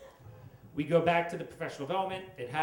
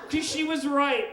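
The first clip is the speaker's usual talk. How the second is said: higher and louder, hammed-up anger; mock rage; 10 semitones higher, 7 dB louder than usual. Comic admits coworker was right, hammed-up loud. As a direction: pitch it up in comic indignation. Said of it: "I'm so angry".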